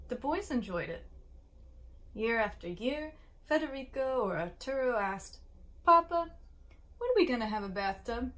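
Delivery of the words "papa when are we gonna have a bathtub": put-on child calling Papa. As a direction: pipe up like a boy pestering his father. Boys ask father about bathtub.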